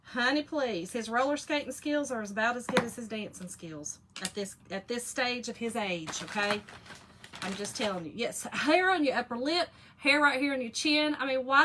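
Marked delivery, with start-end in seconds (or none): none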